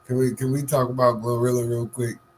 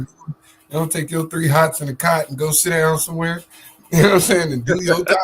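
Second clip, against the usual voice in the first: louder, half-laughing telling-off; mock scolding; 5 semitones higher, 7 dB louder than usual. Co-host telling the jailed rapper to sit down, loud mock scolding. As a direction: scold him like a fed-up elder, laughing through it.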